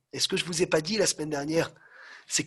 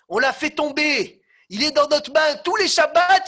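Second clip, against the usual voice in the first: gleeful triumph; 9 semitones higher, 9 dB louder than usual. Acted. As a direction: gloat over what you pulled off. Demons repeat their triumph.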